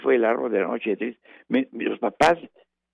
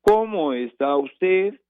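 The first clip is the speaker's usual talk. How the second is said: higher, slow questioning tune on "¿Cómo está usted?"; question intonation; 7 semitones higher, slower than usual. Shown as a demonstration. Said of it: "Ahí hay una interrogación".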